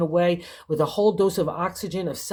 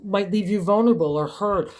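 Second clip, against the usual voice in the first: ordinary talk, nothing marked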